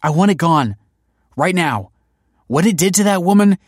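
high, loud, upset insistence; adamant demand. A narrator's reading. Demands the machine be gone.

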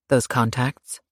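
"Those contacts?" rising on "contacts" as a question; curious question. Acted. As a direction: ask "Those contacts?" with a lifted end. Bodyguard asks about eyes.

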